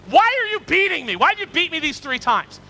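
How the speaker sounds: mock-indignant donkey, high-pitched and loud. Talking donkey to the master beating it, indignant outcry. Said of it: "the donkey says to him".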